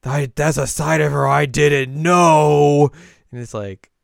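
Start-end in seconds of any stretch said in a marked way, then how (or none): 0.0-2.9 high, loud, slow wail; hammed-up hurt protest; jealous outburst. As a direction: build to a drawn-out jealous cry. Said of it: "be very jealous".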